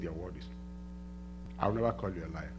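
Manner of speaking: hushed prayer. Retold or imitated. Quiet prayer to God.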